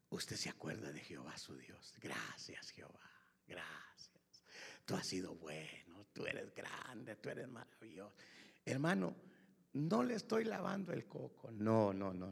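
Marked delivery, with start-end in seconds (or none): 0.1-8.2 low, very soft whispered gratitude; hushed intimate prayer; whispered thanks. Acted. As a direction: breathe out the thanks slowly.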